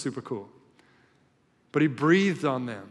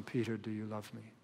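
much softer, lower and hushed; gentle third ask; 5 semitones lower, 15 dB quieter than usual. Acted. Jesus asks Peter a third time, hushed and gentle. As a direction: ask it gently, almost under the breath. Third question, heavy with shame.

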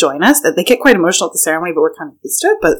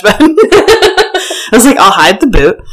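higher, slower laugh-filled line; giggling through the memory; 6 semitones higher, 5 dB louder, much slower than usual. Real, not acted. Rugby player laughing about hiding the drinking boot.

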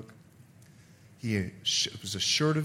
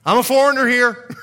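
loud, higher proclamation; telling everybody; 8 semitones higher, 12 dB louder than usual. Acted. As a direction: announce it proudly to the whole crowd. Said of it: "he was telling everybody".

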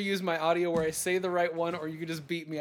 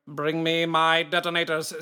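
Hans Gruber voice. Pretend. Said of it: "that's my Hans Gruber voice".

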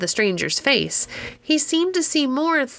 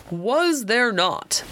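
deep voice; 2 semitones higher, much slower than usual. Acted. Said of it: "in a remarkably deep tone of voice".

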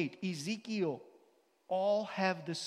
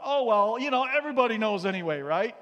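breezy self-excuse; 5 semitones higher, 12 dB louder than usual. Retold or imitated. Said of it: "And I thought".

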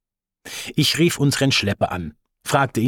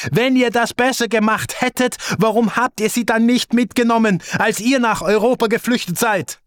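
yelling into the phone; 11 semitones higher than usual. Retold or imitated. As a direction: throw the accusation back furiously. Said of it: "Schrie in den Hörer".

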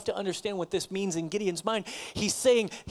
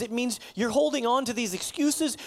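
ordinary talk, nothing marked

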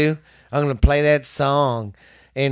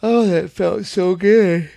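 stretching groan; 5 semitones higher than usual. Real, not acted. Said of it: "I was stretching".

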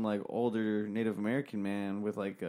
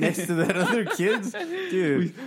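high and louder, laughing through the joke; 6 semitones higher, 6 dB louder, slower than usual. Real, not acted. Laughing as he teases his friend with a dark joke. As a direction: laugh out the punchline.

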